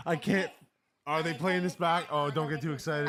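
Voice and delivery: loud high excited talk; breathless joy after goal. Real, not acted. Celebrating goal.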